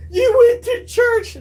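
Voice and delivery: crying shout. Retold or imitated. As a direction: howl it like a hurt teenager. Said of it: "was like".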